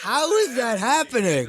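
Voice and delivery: disbelief. Real, not acted. Disbelief at the Wikipedia entry.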